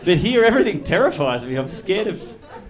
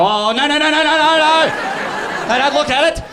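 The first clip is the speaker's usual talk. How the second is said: louder, much higher panicked no no no; hammed-up frantic refusal; mock panic; 6 semitones higher, 4 dB louder than usual. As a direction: panic like someone hiding a secret. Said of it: "and you go".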